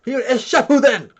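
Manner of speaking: high, loud outraged correction; mock-furious correcting yell; pissed-off nerd. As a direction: imitate a pedant blowing up. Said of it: "he would've got pissed".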